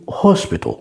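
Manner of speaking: British "hospital" with a rounded O; British pronunciation of "hospital"; British accent. Shown as a demonstration. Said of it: "الجماعة البريطانيين بيقولوا".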